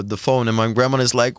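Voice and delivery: ordinary talk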